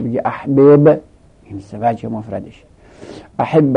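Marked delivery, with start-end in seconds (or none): 0.2-1.0 احبب said as AH-beb, the two b's split by a vowel; unmerged reading. Shown as a demonstration. Teacher showing students the third form, احبب read unmerged. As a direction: undo the doubling in احبب: ah-BEB, no final vowel.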